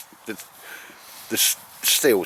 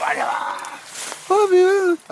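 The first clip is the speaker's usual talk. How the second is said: dog's voice. Pretend. Owner voicing his dog Baldrick to Al, high squeaky dog voice. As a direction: speak for the dog in a squeaky falsetto. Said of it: "He says".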